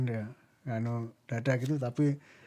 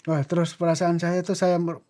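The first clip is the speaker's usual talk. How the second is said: ordinary delivery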